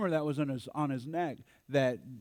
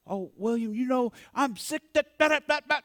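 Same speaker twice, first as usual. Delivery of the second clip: higher, lightly voiced client complaining; imitating a client; 9 semitones higher, faster than usual. Client whining to William, higher imitated voice. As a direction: play the client whining to her counselor.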